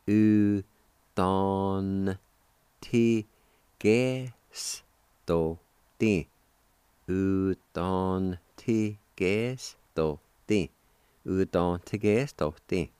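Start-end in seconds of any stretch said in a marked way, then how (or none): 0.0-6.3 Cherokee word broken into separate, drawn-out syllables. Shown as a demonstration. Teacher shows how to pronounce kindest, syllable by syllable. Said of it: "pronounce the N sound with the D A like this". 7.1-10.7 "U ton ti ges to ti" slowly, each syllable held; slow sounding-out. Shown as a demonstration. Teacher models the word kindest for learners, slowly syllable by syllable. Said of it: "like this".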